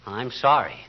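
quiet remorseful husband comforting his wife; tender apology. Acted. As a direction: soothe your crying wife. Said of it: "you got tears in your eyes".

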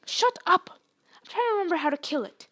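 high, loud irritated outburst; flustered snapping. A narrator's reading. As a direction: snap back, then rush on. Flustered Hermione snaps back in danger.

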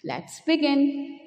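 usual speaking voice

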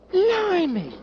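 high, loud Blimey; surprised exclamation. Acted. Drummer boy spotting men in kilts, astonished Blimey. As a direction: blurt it out in amazement. Boy sees kilted Highlanders.

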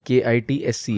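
के आई टी एस सी एच as separate letter names in an even run; spelling out. Shown as a demonstration. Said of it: "इसका pronunciation होगा". (के आई टी एस सी एच अक्षर-अक्षर बोलें)

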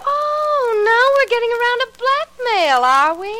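high, scornful mock surprise. [name] sneering at Alan's blackmail threat, high and mocking.

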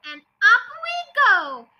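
singsong shout, rising high and loud; gleeful shout. A narrator's reading. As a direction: crow it out gleefully. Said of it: "she shouted".

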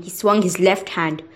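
ordinary delivery, nothing marked

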